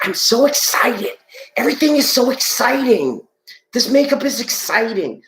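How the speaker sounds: squealing with fake excitement; Chris Farley impression. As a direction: gush like a manic makeup salesman. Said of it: "Chris Farley ... he'd be like".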